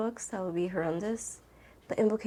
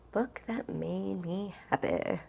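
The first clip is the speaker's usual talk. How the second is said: slow, softer, thinking it over; slow musing; 5 dB quieter, slower than usual. Real, not acted. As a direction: drag out "Book that made me happy" while pondering. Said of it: "repeating the question, thinking".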